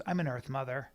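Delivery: low, soft self-description; quoted girlfriend. Retold or imitated. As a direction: imitate her stating it softly. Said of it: "and she goes".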